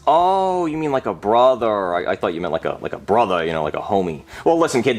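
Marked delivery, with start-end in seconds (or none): none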